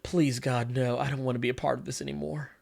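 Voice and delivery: low, soft, defeated plea; quiet mock despair. Host voicing Andrew wanting out, quietly despairing. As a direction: pray it under your breath, worn out.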